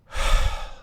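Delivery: long exasperated breathy sigh; audible sigh. Acted. Agent sighs at his author over the phone. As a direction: let out a weary sigh. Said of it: "He verbally sighed over the phone line".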